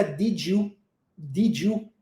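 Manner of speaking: "did you" blended into "didjiu"; linked did you. Shown as a demonstration. Teacher showing how did you links. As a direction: run "did you" together as "didju". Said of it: "a gente junta e fica".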